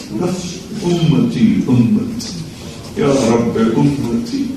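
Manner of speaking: soft, slow plea for his people; tender pleading. Acted. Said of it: "ایک آواز سنو".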